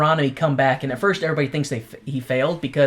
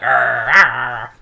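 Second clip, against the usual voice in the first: pirate growls; 3 semitones lower, 5 dB louder than usual. Retold or imitated.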